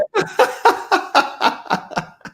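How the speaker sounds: high, breathless burst of laughter. Real, not acted. Laughing after retelling his reaction.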